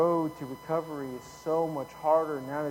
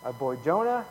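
ordinary talk, nothing marked

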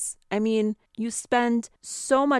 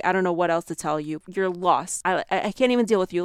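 ordinary delivery

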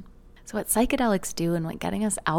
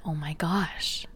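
awed whisper; 2 semitones lower, 6 dB quieter, slower than usual. Retold or imitated. Awed realization about mentor.

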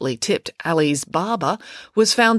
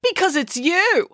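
high, slow finger-pointing tone; accusing; 13 semitones higher than usual. A narrator's reading. Matilda blames April for the killings, accusing. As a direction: lean on the accusation, pitched up. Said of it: "accused Matilda".

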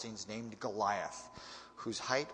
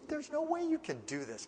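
incredulous Saul; 7 semitones higher than usual. Retold or imitated. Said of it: "So he says".

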